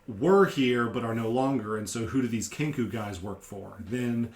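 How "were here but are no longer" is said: normal talking voice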